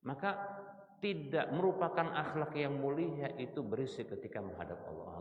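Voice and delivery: ordinary delivery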